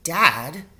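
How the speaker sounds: a sharp protesting call; cry out. A narrator's reading. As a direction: call out in alarm. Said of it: "I cried".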